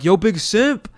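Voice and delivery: yelling out. Retold or imitated. Calling out to a passing streamer, loud yell. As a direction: shout it across to a passing jogger. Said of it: "So I yell".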